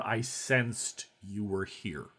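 SENSED drawn out slowly and quietly; slow, hushed quote, lingering on SENSED; pointed repeat. Retold or imitated. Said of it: "She does say the word".

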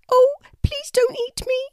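high, trembling little plea. A narrator's reading. Tiny scared kookaburra begging the kangaroo not to eat her, trembling. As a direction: squeak it out, terrified of being eaten. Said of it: "said a trembling voice".

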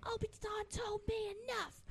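lightly voiced film line, high and soft. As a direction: quote the line high and quietly. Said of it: "acting out Vader scene".

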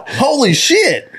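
high, slow, laughing holy shit. Real, not acted. Telling friends the hack story, laughing high. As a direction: crack up in disbelief.

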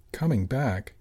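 usual speaking voice

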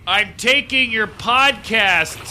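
high, slow, loud call to her; loud slow taunt. Acted. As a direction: draw it out loudly at the lady. Taunting the meditating lady.

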